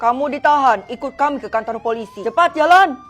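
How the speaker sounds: loud barked commands; stern police orders. Acted. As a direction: order him to move, loudly. Police arrest and march him off. (polisi memerintah dengan keras)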